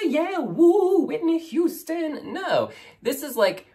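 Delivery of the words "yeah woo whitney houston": higher, slower mock-diva "yeah, yeah, woo"; a hammed-up, higher diva riff; mock diva belter. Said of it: "Not all love songs have to be".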